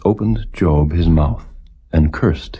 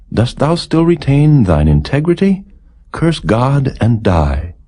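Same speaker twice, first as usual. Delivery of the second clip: higher, scornful wife, slowing on the last words; bitter wife; 7 semitones higher than usual. Acted. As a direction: pitch up and spit out the scorn. Job's wife mocks him.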